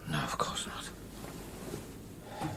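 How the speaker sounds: slow breathy reassurance; soft whisper. Acted. Chris to Rhea in bed at night, slow whisper. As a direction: whisper it slowly, calming her fears.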